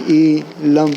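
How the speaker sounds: ای لم in two parts, "ee ... lam"; split-syllable demo. Shown as a demonstration. Teacher splitting the wrong reading, ای لم as "i ... lam". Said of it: "عین کو اگر پہلے رکھ لیں گے".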